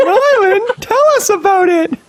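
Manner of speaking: hammed-up host, pitched very high; talk show host. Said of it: "like, a talk show and you're like".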